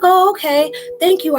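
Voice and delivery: imitating a startled man thanking the driver; surprised high voice. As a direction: act the stunned man taking the tip. Retold surprised man at door.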